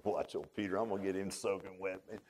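whisper-ish joke among friends; Jesus plotting a prank on Peter; sly Jesus aside. Retold or imitated. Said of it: "Jesus talking to the Holy Spirit".

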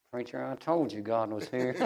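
low, quiet imitation of an old man; soft old saint. Crying old brother to his preacher, softly imitated. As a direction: say it humbly, well under full voice. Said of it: "He just, real slow".

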